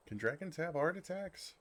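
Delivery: half-joking fright at his own heartbeat; flustered dragon panicking after his confession; mock panic.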